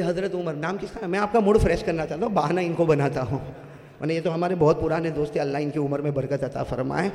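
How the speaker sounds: ordinary talk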